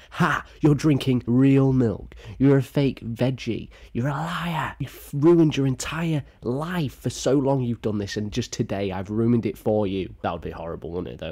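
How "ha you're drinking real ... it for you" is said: higher, louder mocking jeer; gloating taunt. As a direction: rub it in the brother's face. Taunting brother in imagined prank.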